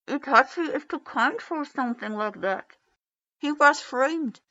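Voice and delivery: put-on high, loud pleading child's voice. A narrator's reading. Boy defending his brother to the leader, high and pleading. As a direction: insist like a stubborn little boy.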